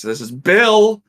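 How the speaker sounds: "Bill" punched high and loud; "Bill" overly enunciated, near a yell; enunciate Bill. Retold or imitated. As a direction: belt out the name "Bill". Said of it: "enunciate Bill".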